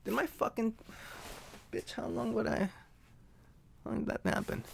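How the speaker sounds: soft, under-the-breath grumble, fading toward a whisper; quiet aside to himself after a slip; muttering to himself. Real, not acted. Mutters to himself over blooper.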